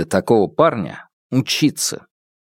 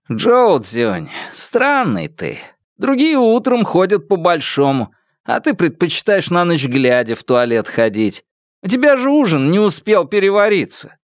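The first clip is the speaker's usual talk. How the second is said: higher-pitched, lively teasing; a hint of a bickering student; lightly voiced teasing roommate; 7 semitones higher than usual. A narrator's reading. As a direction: needle a roommate, pitch jumping up. Roommate needles Zhou Jun in dialogue.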